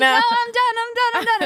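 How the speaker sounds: high, theatrical refusal; singsong, high pretend reluctance; mock-modest performer. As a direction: play the diva waving off an encore. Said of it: "I'm like".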